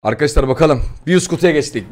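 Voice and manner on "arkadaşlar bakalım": ordinary delivery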